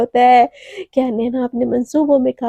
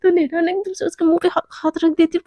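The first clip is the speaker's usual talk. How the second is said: ordinary delivery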